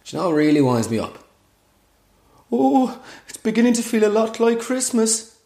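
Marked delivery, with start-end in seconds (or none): 2.5-5.4 high, sing-song festive cooing; mock festive gushing. Influencer captioning a Christmas photo, mock festive gushing. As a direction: coo it in a mocking, held high pitch.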